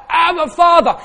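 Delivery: loud high crying out of Abba, Father. Shown as a demonstration. Preacher shows the congregation the cry, loud and high. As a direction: call out like a child to a father. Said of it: "we cry out".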